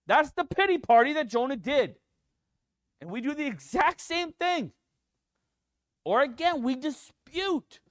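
climbing to a passionate peak; preaching at full force. Acted. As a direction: build the sermon up with fire.

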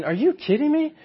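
are you kidding me, said sky-high; incredulous. Retold or imitated. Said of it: "getting right at them and saying".

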